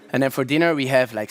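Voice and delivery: usual speaking voice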